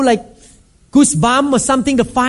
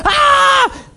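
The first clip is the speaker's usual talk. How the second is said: high-pitched mock scream; panicked scream. Pastor retelling everyone's reaction to fire, acted scream. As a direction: shriek like a crowd fleeing a fire.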